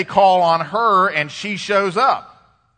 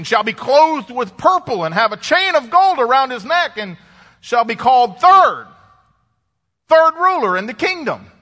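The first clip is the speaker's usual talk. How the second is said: high-pitched proclamation to the court; a king's ringing decree, rising in pitch to the end; king crying out; 5 semitones higher than usual. Acted. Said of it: "The king cries out to these wise men".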